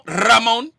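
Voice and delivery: Ramon with a long trilled R; rolled R. Retold or imitated. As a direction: roll the R hard at the start of Ramon. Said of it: "he said his name is Ramon".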